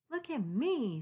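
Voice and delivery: kitty begging to be seen as a unicorn; eager kitty. Acted. Kitty calls to her friends, eager show-off.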